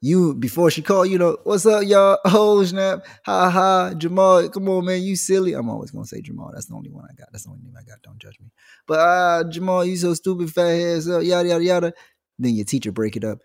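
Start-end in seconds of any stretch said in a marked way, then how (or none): 1.4-5.5 put-on higher, louder laughing kid; cocky class clown. Class clown joking with his friends, loud and high. 9.5-11.9 higher mocking classmate voice; teasing kid. Classmate teasing Jamal, higher voice.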